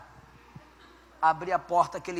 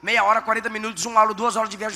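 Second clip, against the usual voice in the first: ordinary delivery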